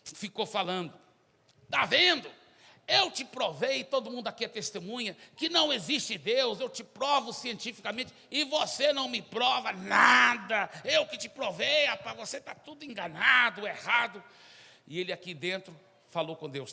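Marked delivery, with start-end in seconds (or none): 1.7-2.3 loud, high, triumphant taunt; loud gloating. Acted. Atheist student gloats at Zé, loud taunting. 2.9-14.2 high, loud mocking boast; boastful shout. Student president taunts Zé before the crowd, loud bragging.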